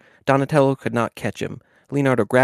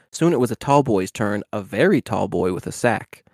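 usual speaking voice

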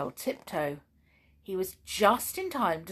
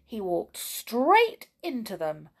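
plain reading voice

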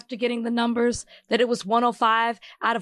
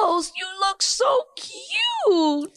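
dog voice cooing; 11 semitones higher, much slower than usual. Real, not acted. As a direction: coo it sweetly to the pet.

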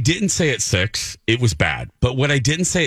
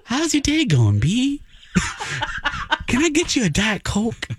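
high, syrupy fake-sweet voice, laughing; butter the boss up sweetly; overly sweet suck-up; 6 semitones higher than usual. Host greeting angry boss on the phone, high sugary appeasing voice. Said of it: "I go, I go".

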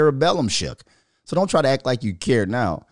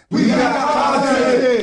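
slow, much higher, louder show name; hype announcer; 12 semitones higher, 5 dB louder, much slower than usual. Acted. Show name hyped announcer style.

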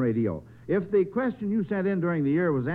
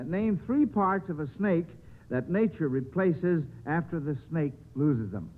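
ordinary talk, nothing marked

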